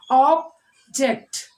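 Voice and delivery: "Object" split in two: Ob, ject; teacher separating "Object" syllable by syllable. Shown as a demonstration. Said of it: "We are splitting the object word".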